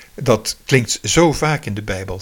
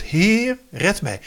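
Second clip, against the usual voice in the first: mild mock cry for help; 8 semitones higher, slower than usual. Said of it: "En hij schreeuwt".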